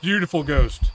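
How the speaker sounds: usual speaking voice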